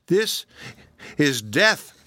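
horrified, shuddering cry; shuddering horror. A narrator's reading. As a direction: recoil from the picture in shock. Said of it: "shuddered Phoebe".

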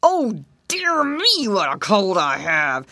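slow, hammed-up creature lament; drawn-out gator misery after sneezing; moaning alligator. Alligator moans after sneezing fit.

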